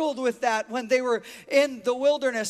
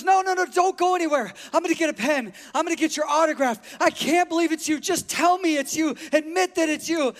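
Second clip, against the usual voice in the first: imitated breathless gushing, higher, tumbling out; excited fan; 4 semitones higher, 3 dB louder, faster than usual. Woman begging a supposed celebrity for his autograph, excited. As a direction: act out a frantic autograph seeker. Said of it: "jumping up and down and hyperventilating".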